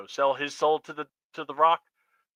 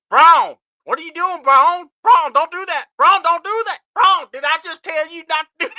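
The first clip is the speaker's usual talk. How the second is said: Southern voice; 13 semitones higher, 10 dB louder than usual. Retold or imitated. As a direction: scold Brown in a hammed-up Southern accent. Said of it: "That Southern voice".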